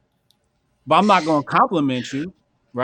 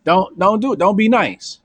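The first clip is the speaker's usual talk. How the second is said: louder, pushed don't be nice; emphatic warning; 4 semitones higher, 3 dB louder than usual. Real, not acted. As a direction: hammer the warning home. Urging emphatically.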